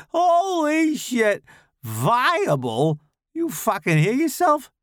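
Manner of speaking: high, loud, mocking disbelief; mock him for sounding fancy; ridiculing Tommy. A narrator's reading. Tommy ridicules Charles in the car, high loud mockery.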